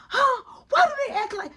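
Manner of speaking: high-pitched, incredulous squawk; exasperated rant. Retold or imitated. Mom ranting about her kids, high exasperated squawk. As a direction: shoot up high in mock outrage.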